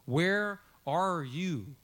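very slow, each word drawn out; three separate words. Shown as a demonstration. Preacher showing the three words of God's question, slow and separated. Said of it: "it's three words in English".

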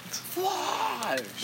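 squeaky high shout; high yelp. Acted. Repeated punchline.

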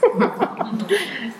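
rire bref et gêné sur le mot anglais; petit rire gêné. Real, not acted. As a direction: laisser échapper un rire d'embarras. Rit de son mot anglais. (petit rire gêné, un peu aigu)

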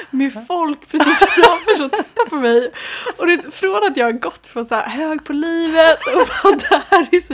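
can't stop laughing at own story; laughing while telling. Real, not acted. Telling a friend about New York embarrassment, laughing.

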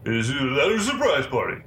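mumbled, slurred tough-guy impression; Stallone. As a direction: do his dead-on delivery, slurring the words. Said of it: "dead on delivery. That's how he delivers".

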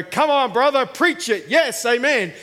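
loud, high cheering; cheering supporter. Retold or imitated. Students backing the preacher, loud excited cheers. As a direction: egg the preacher on, fired up. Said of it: "and go".

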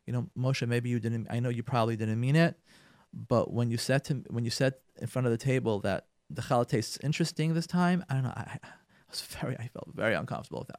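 hushed talk with husband in kitchen; quiet private talk. Shown as a demonstration. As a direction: tell him gently, out of earshot. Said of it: "call him to the kitchen and say".

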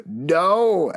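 high, drawn-out mock cry of no; mock scream. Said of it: "just making me scream".

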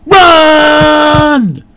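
rising to a frightened scream; panicked shout. A narrator's reading. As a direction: panic building into a yell. Panic building to run.

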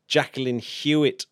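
ordinary talk

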